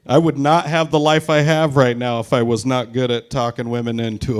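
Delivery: ordinary talk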